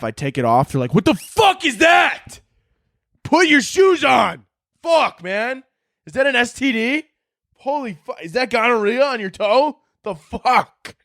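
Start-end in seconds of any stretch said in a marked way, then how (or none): 0.9-4.5 high, loud, slow disgusted yell; horrified shout. Retold or imitated. Friends horrified at toe. 4.8-10.9 high, teasing disgust; mock disgusted friend. Friends mocking the toe.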